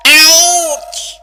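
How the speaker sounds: sharp loud yelp; loud ouch. Acted. Rainbow cut off by tooth pain, loud yelp. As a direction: cry out mid-word in pain.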